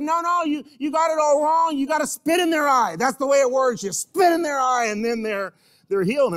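high, loud, insistent shout, certain he's right; emphatic blind man. Acted. Healed blind man insisting to the early church, loud and high. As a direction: bark out the one true method.

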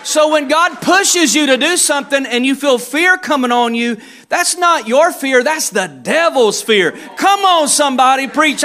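loud, rising sermon climax; preaching at full force. Real, not acted. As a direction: drive it home to the congregation.

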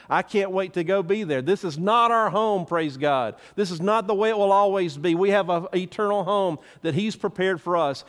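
ordinary talk, nothing marked

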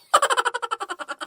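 high, falling chuckle of delight; gleeful laugh. Acted. Pupil settles into teacher's chair, high gleeful laugh. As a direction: laugh high, savouring the moment.

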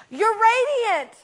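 high, slow, gushing "You're radiant"; admiring exclamation. Retold or imitated. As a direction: gush it out, stretched and bright. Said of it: "this woman goes".

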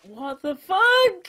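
high, drawn-out cry of disbelief; incredulous yell. Real, not acted. Player killed by the gas, incredulous yell. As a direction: wail it out, stretched and high.

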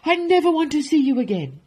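fast, high, loud outburst; angry shout. Acted. Duke banishing the baker, angry shout. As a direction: shout it dismissively in rage. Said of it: "shouted the Duke".

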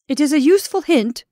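loud, high, fierce reply; furious landowner answering a death threat. Acted. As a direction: spit it back with fury. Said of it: "said Ulrich fiercely".

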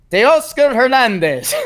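high, hammed-up South American accent; mock South American accent. Host veering off the Canadian bit, mock South American accent. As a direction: belt the name in a comic South American accent. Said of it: "now we're in South America".

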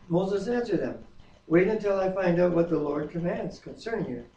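normal talking voice

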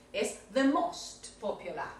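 usual speaking voice

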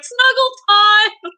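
high, slow, sing-song snuggle time; gleeful childlike man. Retold or imitated. Elf grabbing his dad for a hug, high slow cooing voice. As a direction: coo it out like an overgrown kid wanting a hug. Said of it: "He's like".

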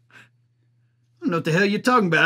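ordinary delivery

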